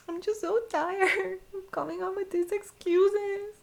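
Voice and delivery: very high, strained plea through laughs; acting out feeble excuses in a high whine; mock whining excuses. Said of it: "But I'm like".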